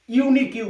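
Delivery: ইউনিকিউ with the letter's own name at the start; U sounded as "yu". Shown as a demonstration. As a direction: lift ইউনিকিউ, keeping the U as "yu". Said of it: "ইউ এর উচ্চারণ ইউ এর মতন হচ্ছে".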